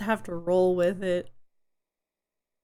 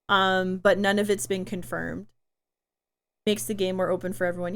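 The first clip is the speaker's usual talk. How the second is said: ordinary talk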